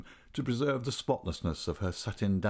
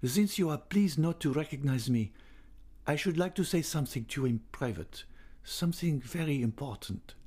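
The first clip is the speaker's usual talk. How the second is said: put-on higher-pitched young man's voice; 6 semitones higher than usual. A narrator's reading. Young man asking the singer for privacy, higher voice. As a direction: make the request in a raised character voice. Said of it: "he said".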